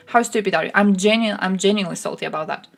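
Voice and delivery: ordinary talk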